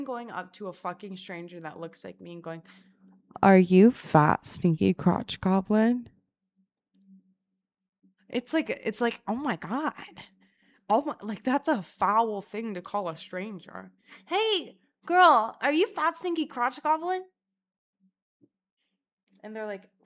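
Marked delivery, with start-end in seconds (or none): 3.4-6.1 loud, slow and drawn out; deliberate mock question. Imagining going up to a lookalike stranger, slow loud question. 14.3-17.2 high, bright-eyed loud call; high-pitched hailing. Acted. Calling out to a random stranger, high hailing voice.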